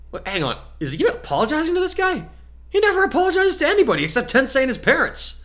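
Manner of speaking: an amazed inner aside; astonished thought. Acted. Boy inwardly amazed his friend is apologizing, high and disbelieving.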